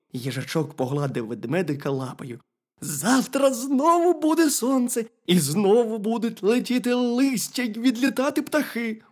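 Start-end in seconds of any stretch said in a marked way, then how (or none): 2.8-9.0 high-pitched hedgehog consoling a friend; reassuring hedgehog. A narrator's reading. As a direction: cheer up the bear in a high voice. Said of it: "Та заспокойся".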